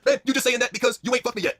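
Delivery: high, loud accusation; mock indignant retort. Moné accusing Tony of bias, high indignant shout. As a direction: snap back in a hammy shout. Acted feud, angry retort.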